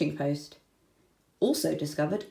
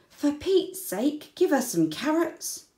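a hint of an exasperated parent, higher and slow; exasperated mother; 8 semitones higher than usual. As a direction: sigh it out, fed up with the wombat. Exasperated human in story.

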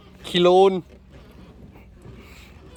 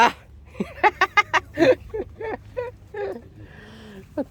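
high, long teasing laugh. Real, not acted. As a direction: burst out laughing at a friend. Teasing friend over sticker. (หัวเราะแซวเสียงสูงยาว)

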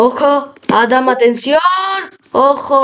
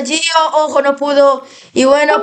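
ordinary delivery

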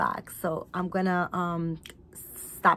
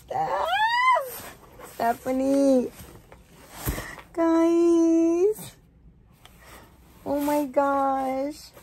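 overwhelmed squeal; 7 semitones higher, much slower than usual. Real, not acted. Overwhelmed by the doll.

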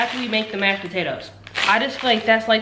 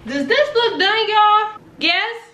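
high questioning; 11 semitones higher, 4 dB louder, slower than usual. Real, not acted. Asking viewers excitedly.

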